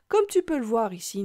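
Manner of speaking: ordinary talk, nothing marked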